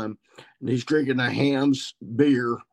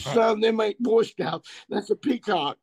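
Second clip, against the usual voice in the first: amused brother; 9 semitones higher than usual. Retold or imitated. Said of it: "he goes".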